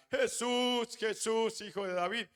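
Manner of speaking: grito agudo de súplica, moderado de volumen; clamor a gritos. Acted. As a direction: clama a Jesús con voz aguda. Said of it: "empezó a gritar". (clamando, con la voz en alto)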